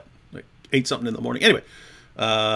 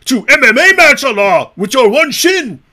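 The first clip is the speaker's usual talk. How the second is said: much higher, loud, strained bragging voice; mocking impression of Hijab; 10 semitones higher, 10 dB louder than usual. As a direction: squeal it like a puffed-up bully. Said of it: "That's how he actually talks".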